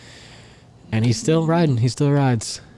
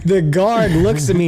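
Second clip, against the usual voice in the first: ordinary talk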